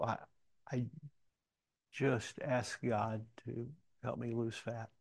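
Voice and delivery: slow, with long hesitations; halting admission. Retold or imitated. Pastor answering a lady who hates diets, halting and reluctant. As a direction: stall on the first word, then admit it slowly.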